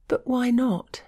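high, breathless gasp of protest. A narrator's reading. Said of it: "she gasped".

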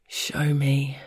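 hoarse, half-stern voice; rough hoarse attempt at stern. A narrator's reading. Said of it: "I'd intended for it to sound stern, but my voice was hoarse".

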